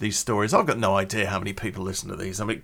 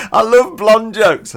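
high, loud, drawn-out delight; 10 semitones higher, 10 dB louder, slower than usual. Real, not acted. Joke teller relishing blonde jokes, loud delight. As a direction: gush loudly, fresh from laughing. Delighted after own punchline.

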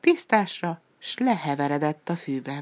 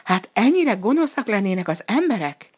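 higher, a touch louder dismay; still frightened; 5 semitones higher, 4 dB louder than usual. A narrator's reading. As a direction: shaken wolf asking in alarm. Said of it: "hebegte rémülten a farkas". (rémült, magasabb hang)

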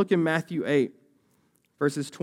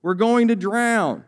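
raised, urgent cry for help, read aloud; shouting; 7 semitones higher, 6 dB louder, much slower than usual. A narrator's reading. Pastor reads the disciples waking Jesus in the storm, shouting. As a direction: call out higher and louder, stretching the last words.

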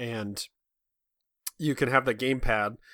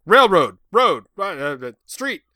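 rapid guessing; 5 semitones higher, 8 dB louder, slower than usual. Shown as a demonstration. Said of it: "just start saying things".

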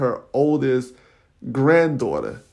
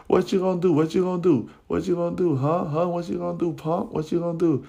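higher, jeering chant, lightly voiced; mock egging on; 5 semitones higher, faster than usual. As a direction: goad him to fight. Girls egging on a fight.

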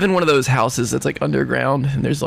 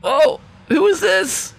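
high creature voice; slower than usual. Acted. Creature meets knight.

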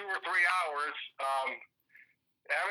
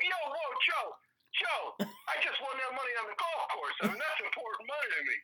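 quoting himself; 9 semitones higher than usual. Retold or imitated. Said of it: "I'm like".

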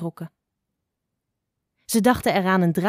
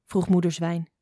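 ordinary delivery, nothing marked

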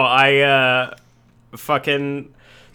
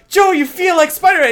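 much higher, loud shout from afar; imitating Little Joe yelling from the street; shouted call; 5 dB louder than usual. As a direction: yell it up to him outside. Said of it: "he'd be like".

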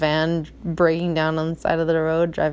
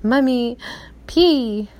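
put-on small child voice; 5 semitones higher, slower than usual. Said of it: "he's like".

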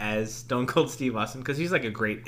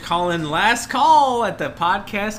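announcer voice; 7 semitones higher, 8 dB louder than usual. Acted. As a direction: ring out last call like a bartender. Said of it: "Hey, that's very good".

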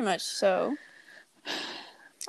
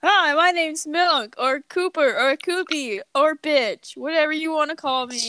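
fake voice; 6 semitones higher, 4 dB louder than usual. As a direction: put on a fake friendly voice. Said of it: "Why do you sound so fake?".

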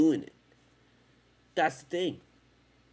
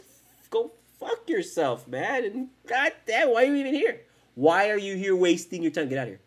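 mock-furious tell-off; 6 semitones higher, 3 dB louder than usual. Real, not acted. Telling off idle viewers.

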